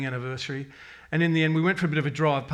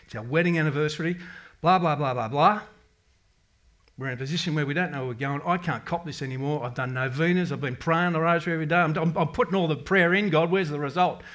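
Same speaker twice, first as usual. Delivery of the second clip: cranky prayer; 3 semitones higher, 6 dB louder than usual. Retold or imitated. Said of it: "It was a cranky prayer".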